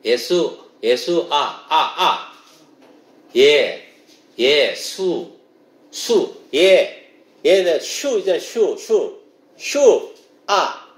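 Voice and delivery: 예, 슈, 아 drilled one sound at a time; syllable-by-syllable pronunciation. Shown as a demonstration. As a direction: break it into separate syllables, with 슈 as "shu". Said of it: "그게 히브리어로 그렇게 부르는 거예요". (한 음절씩 끊어 읽는 히브리어 발음 "예, 슈, 아")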